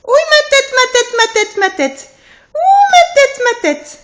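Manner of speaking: high playful fake whimpering; mock crying. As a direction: pretend to cry over a bumped head. Pretend hurt, playing with toddler.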